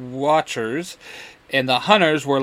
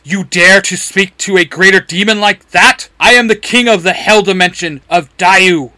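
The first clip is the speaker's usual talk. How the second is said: booming, much louder outrage; furious demon; 5 semitones higher, 10 dB louder than usual. Acted. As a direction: roar your rank at the upstart. Demon king enraged, earth trembles.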